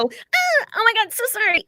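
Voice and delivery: high, frantic apology; mock-panicked high squeal; panicked flee. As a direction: squeal it like you're bolting away. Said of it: "or you go".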